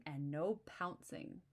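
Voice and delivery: plain reading voice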